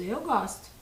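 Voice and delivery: usual speaking voice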